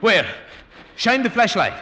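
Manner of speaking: high, loud, pressing demands; urgent shout. Acted. Man urgently ordering his friend to light the cave. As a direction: bark it out in the dark cave.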